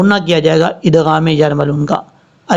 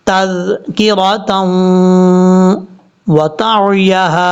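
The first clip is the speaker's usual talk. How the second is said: drawn-out chanted tajweed reading; slow Quran recitation; 2 semitones higher, much slower than usual. Shown as a demonstration. Teacher reciting verse slowly for students. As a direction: recite slowly, stretching each sound.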